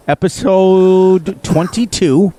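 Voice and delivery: drawn-out announcement. Real, not acted. Announcing the episode number.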